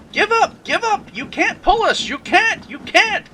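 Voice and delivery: very high, loud jeering chant; squealing trucks taunting. Acted. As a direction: taunt him in a shrill chorus. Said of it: "called the trucks".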